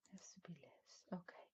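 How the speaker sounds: whispered aside to herself; whispering. Real, not acted. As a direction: drop to a whisper while fiddling. Muttering to self while adjusting camera.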